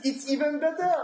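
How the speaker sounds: haughty wine expert voice; lofty sommelier reading the menu. Pretend. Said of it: "What is this voice?".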